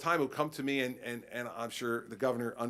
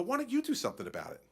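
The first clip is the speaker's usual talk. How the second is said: turning it back; 10 semitones higher, faster than usual. Retold or imitated. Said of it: "I look at people and go".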